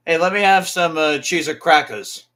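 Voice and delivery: high, loud "crackers" with the final r dropped; lazy pronunciation. Shown as a demonstration. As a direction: ask loudly, saying "crackers" as "crack-uhs". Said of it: "it's incorrect".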